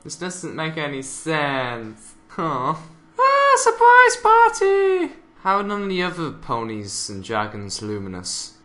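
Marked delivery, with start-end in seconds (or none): 3.2-5.1 very high, loud, drawn-out squeal; surprise shout. Acted. Commentator calls out a surprise party, high squealing shout. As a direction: shriek it out high and long.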